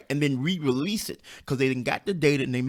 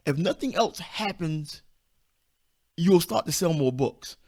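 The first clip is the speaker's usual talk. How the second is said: normal talking voice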